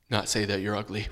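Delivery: low, soft, sulky reply; child forced to answer his mother; reluctant answer. Retold or imitated. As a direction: mutter it because you have to. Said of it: "forcing me to say this".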